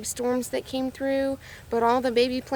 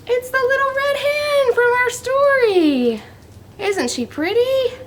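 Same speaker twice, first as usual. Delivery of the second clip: much higher, slow, delighted; excited reveal; 12 semitones higher, 4 dB louder, slower than usual. Real, not acted. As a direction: show it off with big delight. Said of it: "reveals hen to kids".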